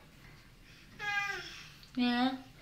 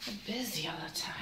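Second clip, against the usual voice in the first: pretend tired whisper; 4 semitones lower, 12 dB quieter, faster than usual.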